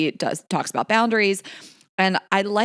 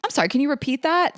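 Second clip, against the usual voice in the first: sweetly raised, faux polite ask; mock innocent; 9 semitones higher, faster than usual. Shown as a demonstration. Answering a condescending coworker, faux polite. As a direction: ask like you missed it on purpose. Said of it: "I just think".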